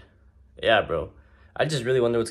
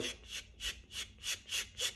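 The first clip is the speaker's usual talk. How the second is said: whispered rapid sh sounds like swiping; scrolling noise; 18 dB quieter than usual. Retold or imitated. Mimicking kids scrolling TikTok, unvoiced swipe noise. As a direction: hiss out the swipe of a thumb.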